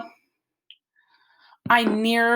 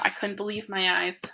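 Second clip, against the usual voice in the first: usual speaking voice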